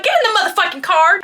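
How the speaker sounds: high, fast, exasperated command; retold urgent yell, rushed and high; urgent order. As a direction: order friends to move right now. Yelling at friends to get in.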